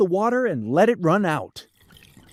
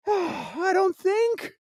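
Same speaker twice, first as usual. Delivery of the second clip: put-on worried child; 12 semitones higher, much slower than usual. Boy doubts.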